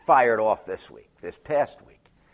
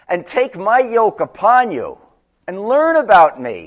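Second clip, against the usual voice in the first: fervent scripture; 8 semitones higher, 8 dB louder than usual. Acted. Quoting Jesus, preaching.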